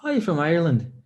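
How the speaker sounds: high, put-on Irish lilt; Irish accent. Shown as a demonstration. Trying out an Irish accent for the viewers, put-on Irish lilt. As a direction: greet someone in an Irish accent. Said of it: "let me know how good my Irish accent is".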